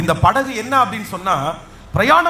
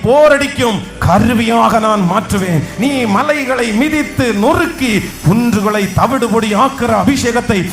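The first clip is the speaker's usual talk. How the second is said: fervent preaching; 2 semitones lower than usual. Acted. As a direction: build the church up with bold conviction. Preaching at full force.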